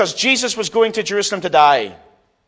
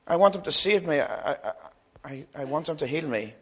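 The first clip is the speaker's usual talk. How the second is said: soft, halting, lowered plea; hushed plea; 3 semitones lower, 7 dB quieter than usual. Acted. Blind beggar longing to be healed, hushed plea. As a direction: falter and plead very quietly.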